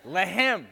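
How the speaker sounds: "Lehem" with a throaty h, leh-hem; "Lehem" pushed out with a rasp. Shown as a demonstration. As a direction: say "Lehem" with a breathy guttural h. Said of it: "Come on, baby".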